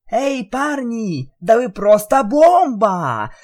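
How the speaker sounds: high, bubbly pony cheer; joyful shout. Acted. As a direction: burst out with joy. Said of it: "радостно прокричала Пинки".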